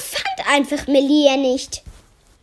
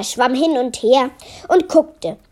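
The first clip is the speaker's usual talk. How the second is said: ordinary delivery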